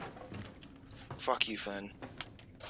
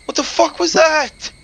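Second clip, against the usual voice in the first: loud startled shout; startled yell; 13 semitones higher, 8 dB louder, slower than usual. Real, not acted. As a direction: yell in fright. Jump scare reaction.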